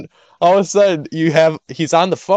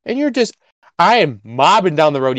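ordinary delivery

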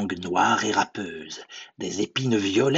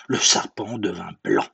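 ordinary delivery

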